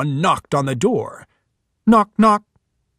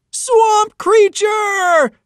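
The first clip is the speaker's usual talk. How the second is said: high, loud, drawn-out shriek of fright; terrified scream; 6 dB louder, much slower than usual. Acted. Boys seeing the monster tower over them, screaming. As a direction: scream it as you see the monster. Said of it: "the boys screamed".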